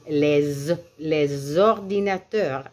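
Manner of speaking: "les" held on its Z, then "les ordinateurs" linked; S sounded as Z. Shown as a demonstration. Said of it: "un son comme un Z".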